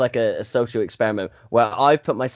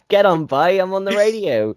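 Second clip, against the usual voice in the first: mock radio presenter; 7 semitones higher, 4 dB louder than usual.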